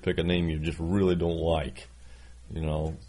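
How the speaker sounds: ordinary delivery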